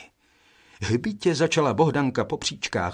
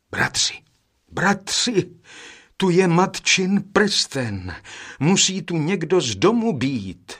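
high, louder cry of joyful discovery; 6 semitones higher, 3 dB louder than usual. A narrator's reading. Eldest brother finding mother's ring, crying out joyfully. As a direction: cry out happily to the others, pitched up. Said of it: "vzkřikl radostně nejstarší".

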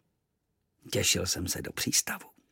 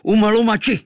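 high, loud foreign-tongue cry; shouted war cry; 12 semitones higher, 9 dB louder than usual. A narrator's reading. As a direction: yell it as you loose the arrow. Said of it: "vykřikl". (bojový výkřik)

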